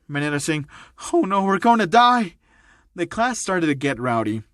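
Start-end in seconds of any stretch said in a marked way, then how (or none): 1.1-2.3 high, loud cry of doom; panicked wail. Acted. Student dreading the new teacher, wailing in panic. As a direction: wail it in mock terror.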